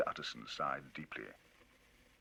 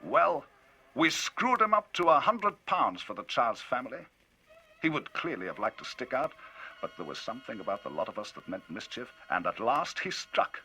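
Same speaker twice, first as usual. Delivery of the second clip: higher, louder, lively telling; Enfield, indignant storyteller; 10 semitones higher, 8 dB louder than usual. Acted. Enfield telling Utterson of the cheque, indignant and loud. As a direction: drive the tale on, indignant.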